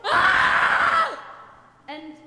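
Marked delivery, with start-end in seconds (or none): 0.0-1.2 short, high, loud shriek of fright; high scream. Acted. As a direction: shriek in terror. Lord screams at the devil.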